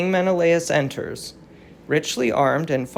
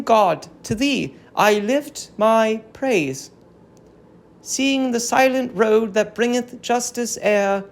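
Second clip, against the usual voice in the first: higher, earnest, praying voice; fervent prayer of an old queen; 6 semitones higher than usual. Acted. Captive queen Hecuba praying to the gods, higher and fervent. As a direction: lift the words to heaven like a grieving queen.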